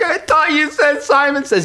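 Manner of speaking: high whiny half-laughing wail; mock sob like an upset child; imitating crying kid. Little boy crying he's out of the game, mock whine. Kid getting really mad.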